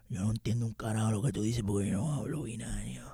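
mock droid answer in a whisper; whispered aside from a confused robot; whispered droid reply.

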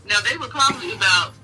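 ordinary talk, nothing marked

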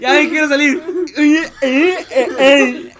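high, loud pleading through laughter; joking cries for help; mock panic. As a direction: beg to get out, hammed-up. Plays a scared visitor begging out.

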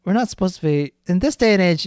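ordinary talk

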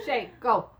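loud, shooing the dog off; loud scolding. Real, not acted. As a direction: raise the voice to send her off.

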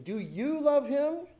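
slow, high, rising question; imitating kids calling out to the crowd; challenge to congregation. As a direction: stretch it upward as a challenge. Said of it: "then to the congregation".

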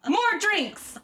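high, punched bar-room call; rowdy demand. Acted. Host acts out Cage pounding the bar, rowdy demand. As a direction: call out a rowdy drink order. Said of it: "pounding the bar".

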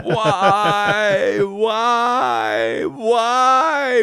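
high, crying wail of why, mock sobbing; hammed-up high sobbing wail; imitating Nancy Kerrigan's cry. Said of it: "I'm doing the Nancy Kerrigan".

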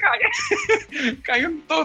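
high laughing voice. Real, not acted. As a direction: laugh while saying it. Laughing at the story.

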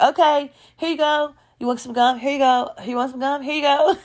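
high, sing-song offering; exaggeratedly casual offer. Offering gum round the friends, mock sweet. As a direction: hand it out in a put-on high, sweet voice. Acting out handing gum around.

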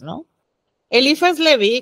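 normal talking voice

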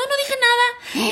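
high, drawn-out mock denial; imitating his protest in a high voice; mimicked denial. Acting fiancé denying what he muttered.

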